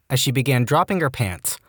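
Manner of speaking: plain reading voice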